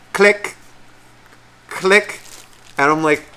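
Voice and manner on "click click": high, loud, crisp clicks with a long pause; imitated camera click. Storyteller mimicking phone photos of bears, high clicks. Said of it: "and just goes".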